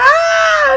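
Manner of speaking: very high, loud shriek; imitated scream. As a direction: scream like the panicked cousin.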